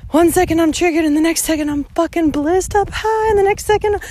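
rising rant about mood swings; fired-up listing of moods; excited rant. Real, not acted. Ranting about her moods.

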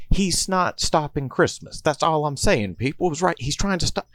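high-pitched, slowed insistence; high excited insisting. Acted. Columbus argues with the others about the Grinch, in a high voice.

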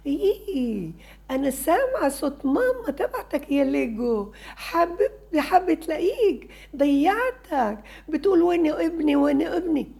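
put-on a dramatic mom voice for a child; loud playful mother voice. Shown as a demonstration. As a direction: play the lost toy's mom calling for her son. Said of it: "بتحكي بصوت عالي".

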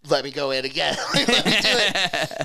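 retold plea through laughter; laughing over the words. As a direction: beg in jest while cracking up.

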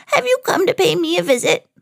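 very high put-on granny squeak; squeaky fake grandmother. Disguised villain asks Minnie about her visit, squeaky. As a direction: pose as granny in a high, funny voice. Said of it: "he squeaked".

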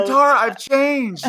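high, loud pleading ex; mock plea. Jet pleading with Katara, high, loud mock plea. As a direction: beg like a sleazy ex.

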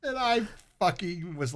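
a high, helpless squeal of laughter. Real, not acted. Laughing hard at wife's bathroom story, high squeal. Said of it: "I was laughing".

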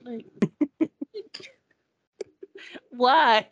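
high, disbelieving asking why, laughing through it; what are you doing, said half laughing; incredulous quote. Retold or imitated. To his therapist, a high disbelieving question. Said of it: "I'm like".

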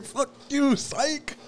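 high gleeful taunt; mock taunt. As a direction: crow it high like a prank reveal. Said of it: "He like".

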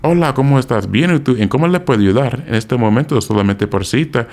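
polite textbook Spanish accent, as a non-Dominican learner; proper Spanish accent. Shown as a demonstration. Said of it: "if I talk to them like this".

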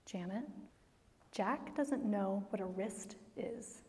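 the Holy Spirit quietly correcting Janet; gentle Holy Spirit. Retold or imitated. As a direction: speak it softly like a calm divine whisper. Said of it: "this is what the Holy Spirit says".

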